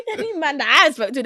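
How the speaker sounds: ordinary talk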